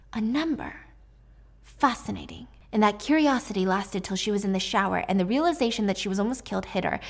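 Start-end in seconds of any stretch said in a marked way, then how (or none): 0.1-2.5 breathy hushed whisper; a soft whisper of discovery; whispered aside. A narrator's reading. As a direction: whisper it like a secret clue. Hushed realization.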